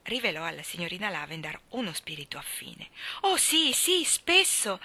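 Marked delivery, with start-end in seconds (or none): 3.2-4.8 higher, slow delighted admission; high, slow eager yes; bolder confession. Acted. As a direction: draw out the yes, thrilled to confess. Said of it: "confessò fattasi più ardita".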